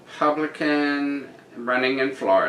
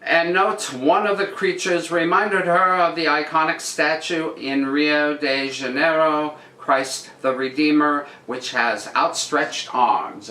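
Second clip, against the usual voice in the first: news read; 4 semitones higher than usual. Acted. Reads the article again.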